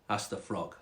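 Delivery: usual speaking voice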